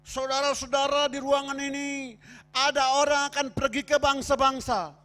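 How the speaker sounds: loud, high revival-preacher call; acted full-force preaching; preacher proclaiming. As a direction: proclaim it to the whole congregation. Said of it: "Sementara khotbah dia berkata gini".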